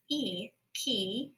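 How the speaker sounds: E and key said apart as two syllables; sounded out. Shown as a demonstration. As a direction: split it as E, key. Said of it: "E Y makes the long E sound".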